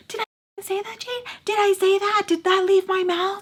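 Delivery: very high-pitched, mocking imitation of an angry mother; mock angry snapping. Imitating mother snapping back at her.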